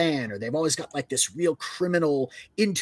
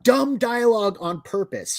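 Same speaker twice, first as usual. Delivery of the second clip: ordinary talk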